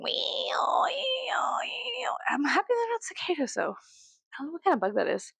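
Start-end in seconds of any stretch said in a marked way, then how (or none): 0.0-2.1 imitating bug noise. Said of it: "bugs outside, um, going".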